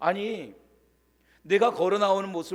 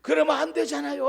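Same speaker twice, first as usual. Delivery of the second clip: tearful outburst; 7 semitones higher than usual. Retold or imitated. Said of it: "쿠션을 때리고 눈물을 흘리고".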